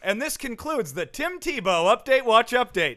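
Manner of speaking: high, loud formal sign-off; mock segment announcer. Host concluding the Tim Tebow update, mock announcer. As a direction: announce the ending with mock ceremony.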